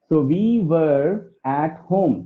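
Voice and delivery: "were" said like "वर", very slowly; careful slow teacher read; slow model sentence. Shown as a demonstration. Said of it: "तो वो वाला वर बोलिएगा".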